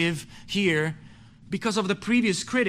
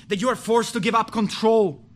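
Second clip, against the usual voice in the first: ordinary talk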